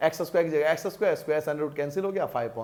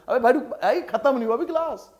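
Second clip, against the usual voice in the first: high-pitched real call; calling students back; 7 semitones higher, 4 dB louder than usual. Real, not acted. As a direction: stop them leaving with a raised pitch. Calling back students leaving the class.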